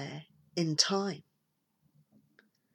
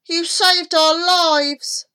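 high, slow, heartfelt thanks; raised, drawn-out boyish gratitude; grateful boy; 10 semitones higher, 5 dB louder, slower than usual. A narrator's reading. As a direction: thank your rescuer with relief. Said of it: "said Norman".

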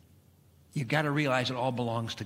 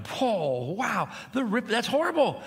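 put-on startled young pastor; shocked whoa; 7 semitones higher than usual. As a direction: react like you just saw a disaster. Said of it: "and I go".

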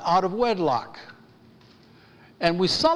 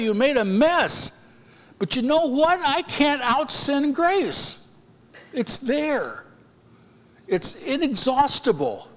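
rising preaching fervor; 7 semitones higher than usual. Acted. Preacher building to full force.

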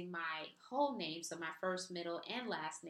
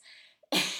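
burst of laughter; 8 dB louder than usual. Real, not acted. Laughs at own joke.

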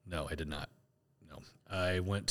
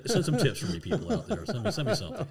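laughing high mock plea; 7 semitones higher than usual. Real, not acted. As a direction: beg playfully while laughing. Joking plea to listeners.

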